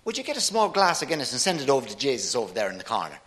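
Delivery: quick Irish drinker ordering; Irish accent. Acted. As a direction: rattle off the order in an Irish lilt. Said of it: "The Irishman said".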